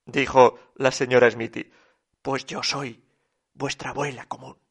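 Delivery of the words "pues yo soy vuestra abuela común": low, soft reply; quiet insistence. A narrator's reading. Susana answering the objection about a common grandmother, low and quiet. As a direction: say it softly with small pauses.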